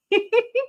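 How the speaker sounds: short high giggle; confused laugh. Real, not acted. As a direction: giggle at your own confusion.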